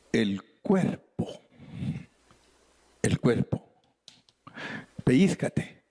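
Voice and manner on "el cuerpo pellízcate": ordinary talk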